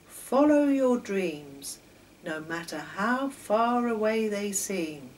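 usual speaking voice

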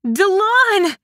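high, loud cry. A narrator's reading. Woman seeing her missing friend alive, crying out. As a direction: call out in shocked relief. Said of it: "she cried".

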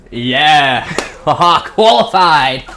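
loud laughing cheer; excited cheer. Real, not acted. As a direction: celebrate loudly. Qualifies again.

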